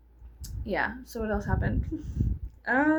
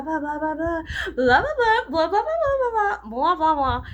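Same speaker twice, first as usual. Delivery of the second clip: mocking blah blah; 8 semitones higher, 7 dB louder than usual. Mocking her own worried thoughts.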